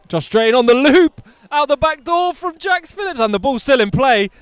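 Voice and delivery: rising, loud play-by-play; excited commentary. Real, not acted. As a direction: call the break with rising thrill. Live break down the field.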